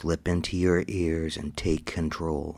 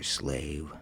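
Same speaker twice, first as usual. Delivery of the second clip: ordinary delivery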